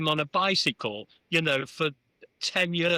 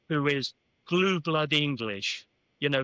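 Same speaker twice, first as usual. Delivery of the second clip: ordinary delivery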